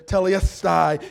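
loud preacher's proclamation; crying out. Retold or imitated. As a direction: call the word out with force. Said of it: "cried out there".

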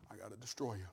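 hushed and low, heavy with sorrow; hushed grief. Acted. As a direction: say it as if it breaks your heart. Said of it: "Can you imagine what that's like".

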